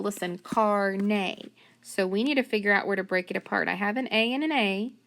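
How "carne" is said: "carne" slowly split as car-nay; syllables separated. Shown as a demonstration. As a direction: sound out CARNE in two stretched beats. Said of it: "more than one syllable".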